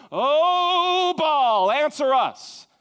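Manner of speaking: a drawn-out high cry to Baal, lightly acted; hammed-up prophets' plea; mock shouting prophets.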